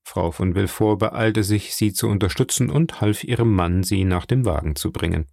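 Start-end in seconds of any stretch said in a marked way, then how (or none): none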